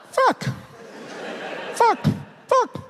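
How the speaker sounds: put-on tiny, very high chirp of a small child; chirping little frog. Kid in the back seat parroting a swear, chirping. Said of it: "Like a little chirping frog".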